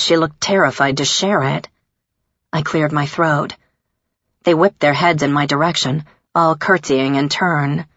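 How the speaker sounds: plain reading voice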